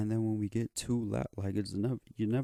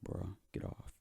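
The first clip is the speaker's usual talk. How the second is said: hushed; 8 dB quieter than usual. Retold or imitated.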